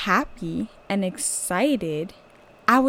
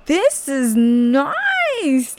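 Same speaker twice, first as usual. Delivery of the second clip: slow, high and louder; savoring delight; 6 semitones higher, 6 dB louder, much slower than usual. Retold or imitated. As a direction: linger over it, pleased. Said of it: "I was like".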